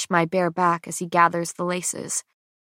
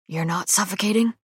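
rough voice. A narrator's reading. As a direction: say it with a hint of roughness. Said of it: "his voice rough".

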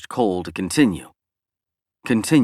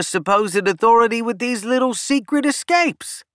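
Cole's voice; 9 semitones higher than usual. A narrator's reading. Cole's dialogue voiced by narrator.

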